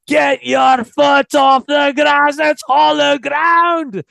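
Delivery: slow, high, full-throated bellow imitating an outraged legend; furious yell. Club legend yelling at a man stepping on his sacred pitch, imitated full-force yell. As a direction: yell it drawn out, as if from the top of a stadium. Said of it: "and he yelled".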